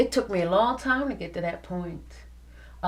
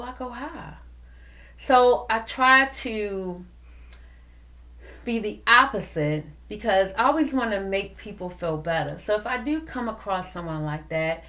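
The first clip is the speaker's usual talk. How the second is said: ordinary talk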